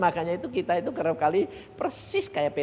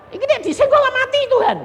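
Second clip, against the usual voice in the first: anxious plea; 13 dB louder than usual. Acted. Pleading fearfully with God.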